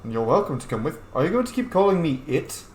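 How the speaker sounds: ordinary talk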